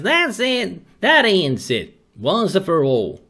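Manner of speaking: high, emphatic matriarch putting her foot down; acted high-pitched finality; Miss Ellie. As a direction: lay down the law in a high voice. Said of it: "Miss Ellie says".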